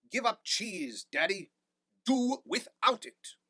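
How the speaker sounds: loud, high shout at his father; shouted plea. A narrator's reading. Son shouting at his father in court, loud and high. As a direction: yell it across the courtroom. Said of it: "stood up and shouted".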